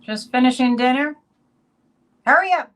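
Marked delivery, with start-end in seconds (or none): 2.2-2.7 playful loud, high hurry-up; mock impatience. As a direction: jokingly shout to rush them along. Teasing a viewer, then just kidding.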